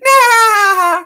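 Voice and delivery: very high, loud cartoon laugh; cartoon cackle. Cartoon sponge showing excitement, very high loud laugh. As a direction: burst into a piercing giggle-cackle.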